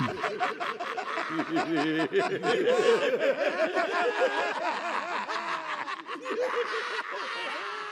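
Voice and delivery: long, high laugh dying away; maniacal laughter. Acted. Villain taking over the brain, long fading cackle. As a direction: laugh madly, fading into the distance.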